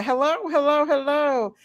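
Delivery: ordinary talk, nothing marked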